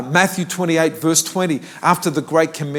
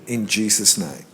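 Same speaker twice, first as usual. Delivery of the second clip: softer, lower prayer line for the congregation; prayer to repeat; 6 semitones lower, 6 dB quieter than usual. Shown as a demonstration. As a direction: lead them gently in a short prayer. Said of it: "I want you to say this".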